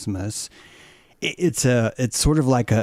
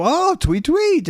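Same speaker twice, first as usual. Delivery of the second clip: very high, louder chirping tweet; excited bird tweets; 5 dB louder, slower than usual. Retold or imitated. Bird answering in canary-ish, high excited tweets. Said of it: "canary-ish kind of language".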